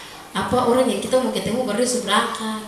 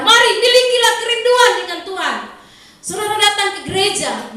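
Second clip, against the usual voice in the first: much higher, louder impassioned preaching; preaching at full force; 10 semitones higher, 4 dB louder than usual. Acted. Pastor urging worshippers to long for God, impassioned. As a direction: cry out to the people with fervour.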